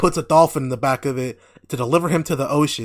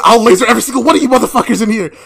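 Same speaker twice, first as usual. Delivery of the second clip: loud, high, rapid rant; mock tantrum with laughter in it; hammed-up furious threat; 8 semitones higher, 9 dB louder, much faster than usual. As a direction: imitate someone snapping at a crowd. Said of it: "he snaps and says".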